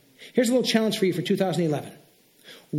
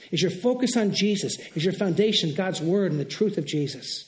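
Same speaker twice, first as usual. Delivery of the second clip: ordinary talk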